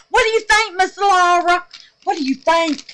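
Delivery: high, loud, laughing; giddy. Real, not acted. Asking Miss Laura about her soap, giddy. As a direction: ask it bubbling over.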